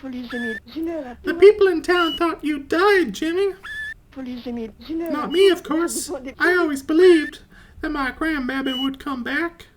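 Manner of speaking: put-on very high, sweet old granny; grandma voice. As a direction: squeak it out like a doting grandma. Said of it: "grandma voice".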